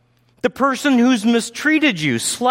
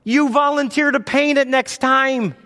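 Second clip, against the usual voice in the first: ordinary talk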